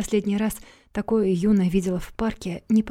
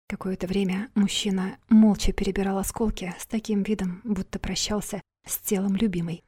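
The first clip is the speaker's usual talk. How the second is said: plain reading voice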